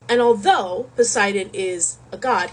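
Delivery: ordinary delivery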